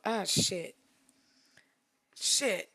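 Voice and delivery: ordinary talk, nothing marked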